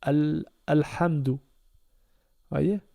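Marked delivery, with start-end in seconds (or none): 0.0-1.3 Al- then Alhamdou, slowly, with a clear opening A; slow demonstration. Shown as a demonstration. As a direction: sound out Alhamdou syllable by syllable. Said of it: "il va se lire comme si c'est un alif avec un A".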